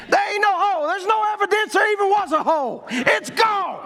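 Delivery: high, excited preacher's holler; exultant retold verdict. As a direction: cry out the healing in triumph. Retold doctor's news, exulting.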